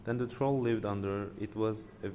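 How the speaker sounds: ordinary delivery, nothing marked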